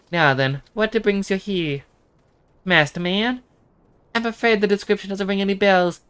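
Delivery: a dutiful daughter hearing of her father; voiced daughter NPC. Voicing the same NPC.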